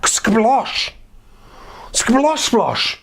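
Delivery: high, drawn-out splash noises; imitated splash. Storyteller replays troll landing in river, high splashes. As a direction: make a big high-pitched splash.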